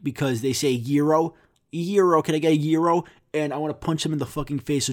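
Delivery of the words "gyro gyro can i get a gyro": "gyro" said YEER-oh with an American r; mock people saying "gyro" as YEER-oh; non-Greek mispronunciation. Mimicking non-Greek customers ordering, "gyro" mispronounced YEER-oh with American r. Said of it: "I hate when people say that, that aren't Greek".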